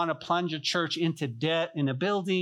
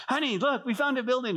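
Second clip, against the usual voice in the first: high, eager retold excitement; excited; 8 semitones higher than usual. Husband showing his wife the little building, acted excitement. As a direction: pitch it up, showing off a great find. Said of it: "I'm so excited".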